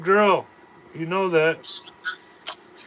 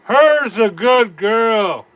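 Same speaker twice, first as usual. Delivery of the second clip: louder and slow, pitched up; drawn-out loud repeat; 5 semitones higher, 8 dB louder, much slower than usual. Retold or imitated. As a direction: stretch it out and belt it.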